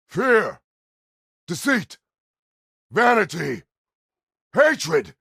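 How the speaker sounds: high, explosive single-word shouts; roared list. Acted. Naming vices one at a time, each a furious shout. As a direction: bellow each word alone, with a pause after it.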